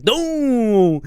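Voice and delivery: a high, loud crash "boom"; imitated crash. Vlogger describing driver hitting a biker, loud boom. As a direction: slam the sound of impact.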